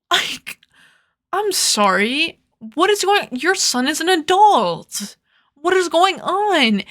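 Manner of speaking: high-pitched, drawn-out mock disbelief; incredulous retort. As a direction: retell it as a baffled, exasperated objection. Retold incredulous retort to complaining mother.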